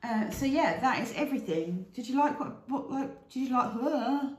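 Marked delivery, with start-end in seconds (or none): 1.9-4.3 stumbling over the words; tongue-tied. Real, not acted. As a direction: trip over the question, higher. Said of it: "can't speak".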